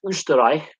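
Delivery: ÖSTERREICH with rounded Ö and final "eye-k"; German pronunciation. Shown as a demonstration. Explaining how they say Austria, German pronunciation. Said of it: "is how they say Austria".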